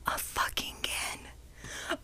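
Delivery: exasperated whisper. Real, not acted. Venting to listeners in a whisper. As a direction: hiss it out in disbelief. Exasperated about grandma visiting again.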